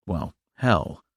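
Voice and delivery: plain reading voice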